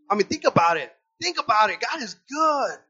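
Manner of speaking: preaching fervor. Real, not acted.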